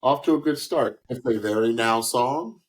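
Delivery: ordinary talk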